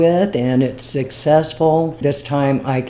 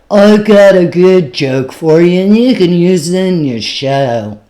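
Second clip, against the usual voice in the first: imitating the drunk guy; 4 semitones higher, 8 dB louder than usual. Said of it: "He is super drunk".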